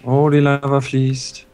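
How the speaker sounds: slow marvel. Real, not acted. Repeats it, drawn out.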